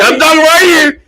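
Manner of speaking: high-pitched imitation of a wrestler; mock wrestling declaration; mock promo voice. Mimicking a wrestling promo cutter.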